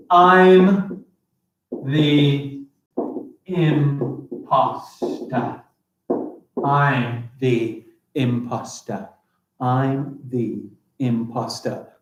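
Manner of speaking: slow, spaced-out dictation for the class; slow deliberate dictation with long pauses. Shown as a demonstration. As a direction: spell it out word by word, slowly. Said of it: "Five syllables".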